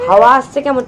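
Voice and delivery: normal talking voice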